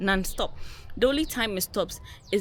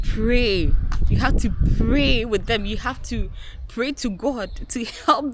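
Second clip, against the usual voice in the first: louder, urgent appeal to the men; emphatic plea; 4 semitones higher, 8 dB louder than usual. Real, not acted. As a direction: press it on them, insist. Urging husbands to pray.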